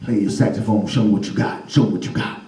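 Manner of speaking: low elder voice; old people daring the kid; deep old neighbor voice; old folks. Acted. Old porch folks speak.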